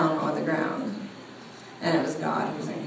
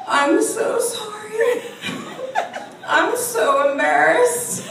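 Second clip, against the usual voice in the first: high, drawn-out mock wail; hammed-up mortified apology; 4 dB louder, slower than usual. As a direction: imitate a panicked man apologising, slow and squeaky. Retold shaking passenger's mortified apology.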